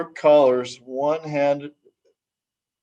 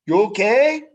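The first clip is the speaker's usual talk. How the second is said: concerned brother; 7 semitones higher than usual. Acted. Brother checks on fallen sister.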